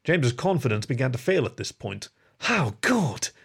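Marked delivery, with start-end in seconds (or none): none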